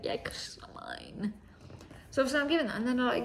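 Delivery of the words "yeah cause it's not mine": slow whispered snark; slow whispered it's not mine; whispered retort. Retold or imitated. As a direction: drawl it softly, deadpan and exasperated. Retort to the vet's staff.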